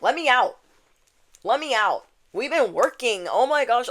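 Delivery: put-on impatient child begging to be let out; inner child. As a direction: plead like a cooped-up kid. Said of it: "Your inner child is like".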